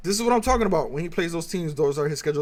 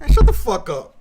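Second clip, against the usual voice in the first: louder, a real angry blast; loud shut up; 8 dB louder than usual. Real, not acted. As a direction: bark it out, fed up with the excuses. Ranting at the player's excuses.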